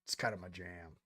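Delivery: deep casual bro voice. Retold or imitated. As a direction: drop the voice low and casual, like it's obvious. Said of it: "I'm like".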